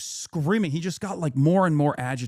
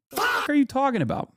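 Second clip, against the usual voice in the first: baffled; 10 semitones higher, faster than usual. Retold or imitated. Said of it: "And I was like".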